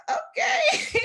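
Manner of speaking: high, laughing okay; a small laughing okay after being shut down; stunned okay through laughter. Retold or imitated.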